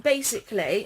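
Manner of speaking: ordinary talk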